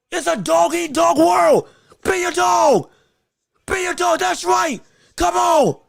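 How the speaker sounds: loud, high, slow yell of encouragement; fired-up shouting. Real, not acted. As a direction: roar at him to be a dog. Fan screaming at the fight.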